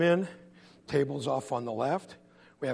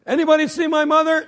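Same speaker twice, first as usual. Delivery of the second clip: high, loud call to the lookouts; a kid's urgent holler; 12 semitones higher, 11 dB louder than usual. Acted. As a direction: yell it out to friends across the fence. Boy calls to lookout friends.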